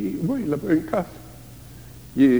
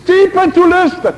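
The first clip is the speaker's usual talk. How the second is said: high, loud exclamation; emphatic shout; 10 dB louder than usual. Acted. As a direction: cry the word out. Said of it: "Ce-i cu țipătul ăsta". (exclamație puternică)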